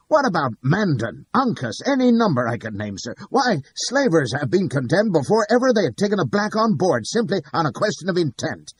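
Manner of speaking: loud, high heated argument; bawling. Acted. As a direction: keep bellowing your point at the judge. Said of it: "bawls Clitheroe".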